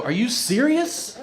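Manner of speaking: high, drawn-out disbelief; imitated incredulity. Hospital staff reading the heroin note, imitated high incredulity. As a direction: ask it slowly with the pitch climbing.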